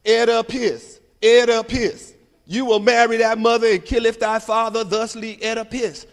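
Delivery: hammed-up kid shouting his lines. Classmate reading Oedipus aloud in class, loud and hammed-up.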